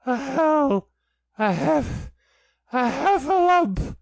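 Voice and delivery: high, loud gasping squeak; breathless panic. A narrator's reading. Piglet, out of breath, blurts to Christopher Robin in high panic. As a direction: gasp the words out, too winded to finish. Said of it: "breathing so hard that he could hardly speak".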